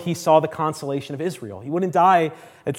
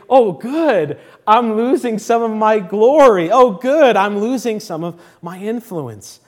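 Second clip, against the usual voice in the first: loud, raised-pitch mock delight, easing at the end; happy; 4 semitones higher, 7 dB louder, slower than usual. Preacher voicing John happy to lose his glory, gladly exclaiming. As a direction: exclaim it gladly, as if welcome news. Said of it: "He's happy to say".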